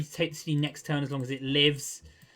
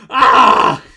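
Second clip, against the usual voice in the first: sudden loud cry of frustration; a short angry shout at the screen; loud frustrated yell; 6 semitones higher, 17 dB louder than usual. Real, not acted. Frustrated after a bad trade.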